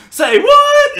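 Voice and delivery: a high, loud squawk of disbelief; shocked shout. Retold or imitated. Retelling the heroine breaking the romantic moment, shocked shout. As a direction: blurt it out, way up high.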